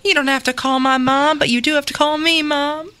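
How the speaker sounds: insistent Southern mama; acted Southern motherly plea; Southern accent. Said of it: "do this in a Southern accent".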